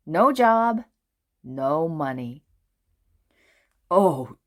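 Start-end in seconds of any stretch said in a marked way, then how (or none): 3.9-4.4 sighing. A narrator's reading. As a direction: let it out as a weary sigh. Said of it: "he sighed to himself".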